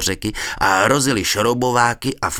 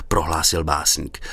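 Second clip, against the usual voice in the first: ordinary delivery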